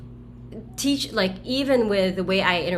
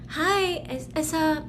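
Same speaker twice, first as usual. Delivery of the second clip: a higher, polite caller voice; hospital caller; 9 semitones higher, slower than usual. Retold or imitated. A hesitant caller on the phone asking for the doctor, higher pitched. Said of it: "someone from the hospital".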